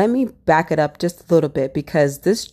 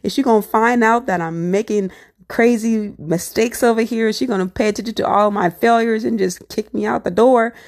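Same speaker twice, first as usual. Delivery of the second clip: higher, rushed panicky questions; mock-frantic self-talk, pitched up; panicked inner voice; 5 semitones higher than usual. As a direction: spiral into worst-case fears. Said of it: "I'm freaking out".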